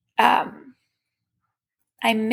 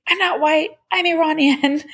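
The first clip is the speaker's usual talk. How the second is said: bold, higher and louder, proud of her roots; proud declaration; 6 semitones higher, 3 dB louder than usual. Retold or imitated. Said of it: "I'm like".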